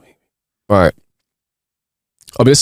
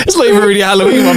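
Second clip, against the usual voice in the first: high fast laughing talk; faster than usual. Real, not acted. Teller laughing high and fast after his dark joke. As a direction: laugh and rush the words.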